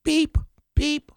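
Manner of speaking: high, bright bleep sound over a swear word; mock censor bleep. Shown as a demonstration. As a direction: bleep out the curse yourself with a high beep. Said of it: "why can't I sit here and say".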